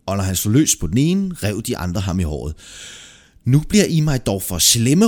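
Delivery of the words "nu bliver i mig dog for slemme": louder, higher outburst; exasperated soldier's shout; fed-up shout. A narrator's reading. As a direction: raise your voice, you've had enough. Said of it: "råbte han".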